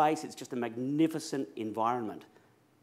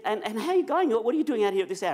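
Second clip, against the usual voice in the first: much higher, louder, fast woman's voice; imitating the woman; 8 semitones higher, 4 dB louder, faster than usual. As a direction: do her eager questions up high. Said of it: "And she said".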